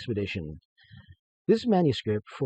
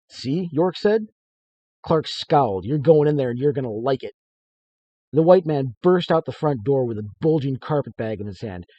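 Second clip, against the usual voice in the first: lively read-aloud narration, a bit louder; story narration; 3 semitones higher, 8 dB louder than usual. A narrator's reading. Author reading his story to listeners, lively narration. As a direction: read the tale with energy.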